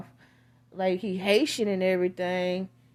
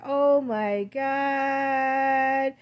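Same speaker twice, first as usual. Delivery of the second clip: stunned shock; 5 semitones higher, 3 dB louder, much slower than usual. Retold or imitated. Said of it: "He was like".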